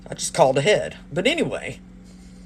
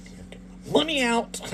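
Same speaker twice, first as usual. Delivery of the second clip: laughing whisper; 7 semitones higher, 6 dB quieter, faster than usual. Retold or imitated. Retold aside while being robbed.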